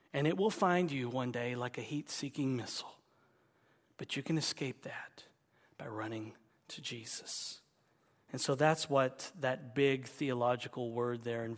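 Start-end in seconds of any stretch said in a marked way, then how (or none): 4.0-7.6 soft unvoiced whisper. Real, not acted. Preacher whispering the way of escape. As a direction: hush it as an invitation.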